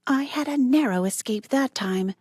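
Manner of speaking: high, breathless relief; relieved. A narrator's reading. Nancy running from the barn, relieved. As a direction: shaken but thrilled to escape. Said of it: "Nancy told herself".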